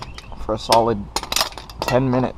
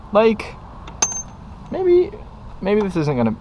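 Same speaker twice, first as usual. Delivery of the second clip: hopeful excitement; 10 semitones higher than usual. Real, not acted. Excited hope before jinx.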